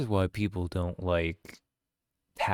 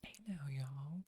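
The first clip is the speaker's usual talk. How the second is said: whispered southern greeting; 7 semitones higher, 17 dB quieter than usual. Acted. Lotta greeting everyone in a whisper. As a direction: breathe it out, no voice. Reading Lotta's line again.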